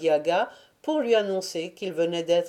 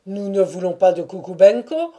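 forceful objection, louder than the narration; loud retort; 8 dB louder than usual. A narrator's reading. Other Cossacks rejecting Kukubenko, retorting loudly. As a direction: answer back loudly from the crowd. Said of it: "répondirent les autres".

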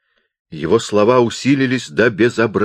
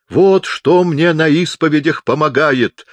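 high, loud, slow announcement; loud enthusiasm; 8 semitones higher, 5 dB louder than usual. A narrator's reading. Chaplain produces a megaphone for the visitor, loud and eager. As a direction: proclaim your handy discovery loudly.

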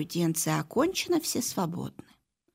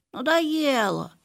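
slow, drawn-out whine; bored whine; 6 semitones higher, 3 dB louder, slower than usual. Acted. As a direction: whine it out, fed up. Dog voiced, bored.

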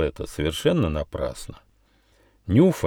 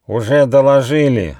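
nasal, nasty little voice; 4 semitones higher, 6 dB louder than usual. A narrator's reading. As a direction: speak through the nose. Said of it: "раздался гнусавый голос".

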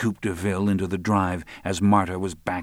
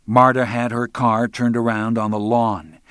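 usual speaking voice

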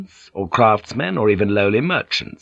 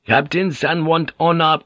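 higher-pitched, halting helpful question; villager's helpful offer, pitched up; helpful foreign villager; 7 semitones higher than usual. A narrator's reading. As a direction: offer it eagerly in broken English. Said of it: "he asked helpfully".

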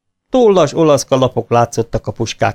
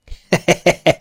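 short high laugh; soldier laughing; 7 semitones higher than usual. A narrator's reading. Soldier laughing at the bear's boast, short laugh. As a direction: laugh at the joke. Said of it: "Nevetett a katona".

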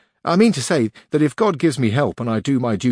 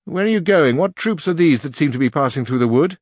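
higher, louder urgent questions; loud questioning call; 6 semitones higher, 4 dB louder than usual. A narrator's reading. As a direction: demand answers across the clearing. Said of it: "cried the Duke".

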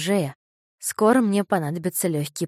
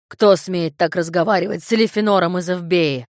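louder pompous big man; pompous fat man; 7 dB louder than usual. A narrator's reading. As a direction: puff up with offended pride. Glutton's pompous outrage.

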